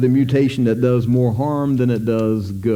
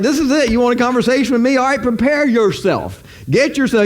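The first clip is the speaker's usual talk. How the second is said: higher, teasing fight-talk as God; taunting God; 8 semitones higher than usual. Retold or imitated. Preacher voicing God taunting Job, higher and teasing. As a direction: goad him like a boxer before a bout. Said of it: "it's like".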